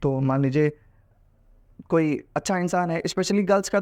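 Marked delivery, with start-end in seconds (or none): none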